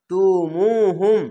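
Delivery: "তুমুহুম" slowly, with a long মু; one-alif stretch. Shown as a demonstration. Teacher reciting for learners, "তুমুহুম" with its vowel stretched. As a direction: draw out the মু in "তুমুহুম". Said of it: "এক আলিফ টেনে পড়বেন".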